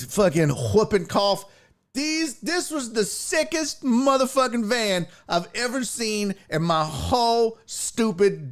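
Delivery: ordinary delivery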